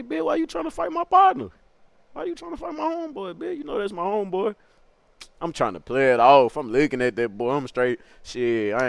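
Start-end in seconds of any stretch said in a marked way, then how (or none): 0.0-3.6 much higher, smooth put-on street voice; Baby Josh's smooth voice. Baby Josh taunting the narrator before hitting him, smooth high voice. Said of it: "he accent on some smooth shit". 3.6-4.5 softer, lightly raised smooth voice; Baby Josh's smooth voice. Retold or imitated. Baby Josh insisting to the narrator, softer smooth voice. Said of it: "he accent on some smooth shit".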